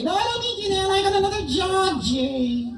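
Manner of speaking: squeaky high puppet voice, shouted out; a cheeky dummy yelling hello from the box; ventriloquist dummy Bob. Acted. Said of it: "we're gonna do some ventriloquism here".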